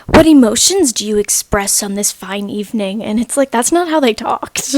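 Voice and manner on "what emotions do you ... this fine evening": mock old-timey stiltedness; hammed-up fake archaic delivery; weird old-timey. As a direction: overdo the stilted period-drama line. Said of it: "they're going to say things weirdly".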